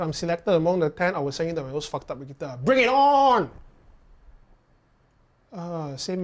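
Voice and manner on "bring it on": loud, high, fired-up call; eager challenge. Real, not acted. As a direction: dare them to send it, loud and high. Challenge to send worst guitar.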